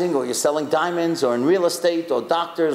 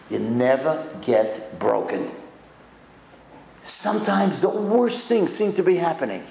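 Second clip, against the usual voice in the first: normal talking voice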